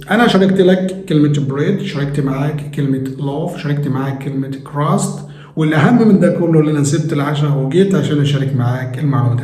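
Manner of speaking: usual speaking voice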